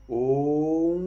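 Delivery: O through the nose; nasal O. Shown as a demonstration. Teacher showing the nasal on sound. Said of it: "sientes que tu nariz trembla".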